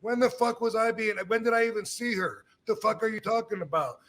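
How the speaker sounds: high, incredulous anger; indignant outburst. Retold or imitated. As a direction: demand an answer, rising. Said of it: "I said".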